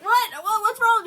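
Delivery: high, loud startled questions; retold shout of concern; alarmed reaction. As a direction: imitate a husband calling out in alarm. Acts out husband's alarmed reaction.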